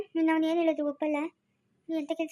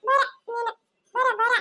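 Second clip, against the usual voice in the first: calling voice; 7 semitones higher, slower than usual. Acted. High put-on voice calling.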